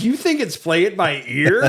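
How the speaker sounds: retold incredulous scoff; scoffing disbelief. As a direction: laugh at the correction, rising on "ear". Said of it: "And I laughed at her".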